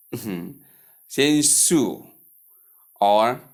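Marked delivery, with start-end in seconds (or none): 1.4-2.0 "su" with plain s for sh; mispronunciation demo. Shown as a demonstration. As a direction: say "su" like Sue, as the error. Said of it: "Common error".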